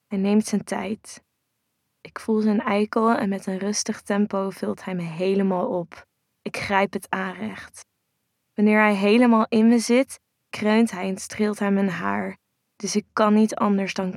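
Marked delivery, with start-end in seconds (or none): none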